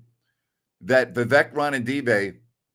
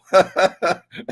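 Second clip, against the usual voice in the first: a burst of loud, high laughter at his own punchline; big real laugh; 7 semitones higher, 5 dB louder than usual. Real, not acted. Co-host cracking up at the tux story, loud laughing.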